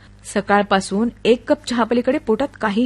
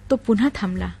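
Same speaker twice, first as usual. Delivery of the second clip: plain reading voice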